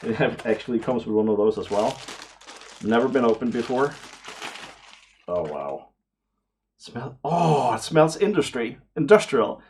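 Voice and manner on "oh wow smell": ordinary talk, nothing marked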